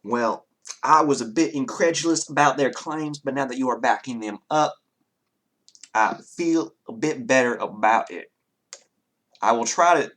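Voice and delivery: put-on courtly Southern-accented townsman. Townsman agreeing to help the party, genteel Southern accent. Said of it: "or in that accent".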